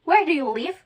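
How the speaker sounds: "where do you live?" as a tune example; intonation demonstration. Shown as a demonstration. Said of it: "Intonation is produced because of different pitches".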